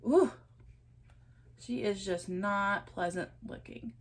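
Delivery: ordinary delivery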